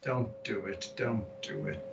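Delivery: low, fading murmur of warning; quiet warning. Pretend. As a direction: warn them under your breath, trailing off. Warning the spellcaster, fading away.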